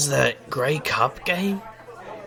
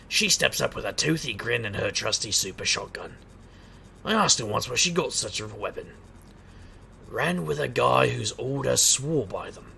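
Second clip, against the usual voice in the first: quiet, measured narrator voice introducing a friend; character introduction narration; 4 dB quieter than usual. A narrator's reading. Valentine introducing Razor to the table, quiet narration.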